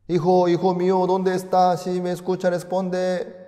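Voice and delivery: high, loud, desperate call into the radio; anxious father calling. Acted. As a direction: cry out for a lost son over the radio.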